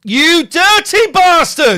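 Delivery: loud, slow, high yell; outraged shout. Real, not acted. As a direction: bellow it in disgust.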